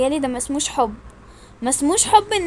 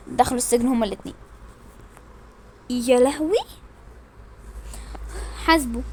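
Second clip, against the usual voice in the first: usual speaking voice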